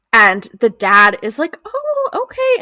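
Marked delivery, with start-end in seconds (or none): 1.6-2.6 high-pitched imitation of an appeasing dad; mock-agreeable, voice lifted way up; placating dad.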